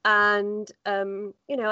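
usual speaking voice

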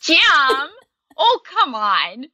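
put-on playful Tammy Faye accent on Jim; Tammy Faye Minnesota accent. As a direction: drag out the vowels in a cute Minnesota accent. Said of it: "the way she said Jim".

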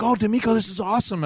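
imitating excited fans. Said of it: "they were like".

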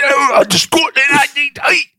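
very high, garbled British dialect; hammed-up incomprehensible British voice; mock regional British accent. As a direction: squawk it out in unintelligible northern British. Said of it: "and then you get".

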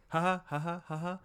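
high, put-on ha-ha syllables; mock laugh. Guest mocks how tropes get said, high fake laughing. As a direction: fake a knowing little laugh. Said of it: "like, saying them, like".

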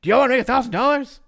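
loud mock preacher pitching to a congregation; hammed-up pulpit call. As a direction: boom it out like a showman pastor. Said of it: "he asks his congregation every week".

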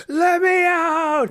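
long, high strained scream; drawn-out high-pitched cry for release. Acted. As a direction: scream it like a chained-up prisoner, drawing out each word. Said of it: "chained up screaming".

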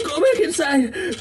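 high, crying plea; desperate crying plea. Acted. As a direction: sob it out, begging. Begging in clip.

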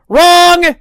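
high, loud playful shout; spring the twist on them; loud gotcha. Real, not acted. Reviewer springing the surprise finish on viewers, loud. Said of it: "gotcha reveal to viewers".

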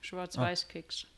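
talking through a bite of cookie; chewing while answering, mouth full. Real, not acted. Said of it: "Mit vollem Mund". (mit vollem Mund)

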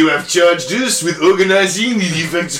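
hammed-up French accent. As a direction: put on a mock French accent. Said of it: "I can't do a French accent for long periods".

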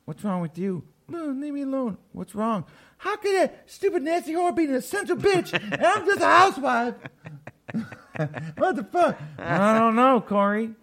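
high, whiny housewife voice; husband's wife whining high and shrill; upset housewife. Acted. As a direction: play a jealous housewife complaining. Acted housewife voice, much higher.

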